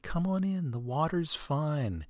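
ordinary delivery

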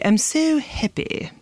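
put-on high, slow royal British accent, "happy" as "heppy"; Queen Elizabeth. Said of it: "the way that Queen Elizabeth and".

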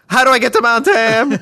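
impatient driver shouting out the car window; loud, high mock driver voice. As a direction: shout it high and far above her voice. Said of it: "it's like".

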